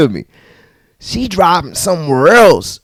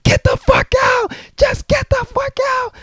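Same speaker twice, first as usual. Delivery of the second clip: far higher screamed order; mock screaming. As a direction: scream it again. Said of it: "she's like".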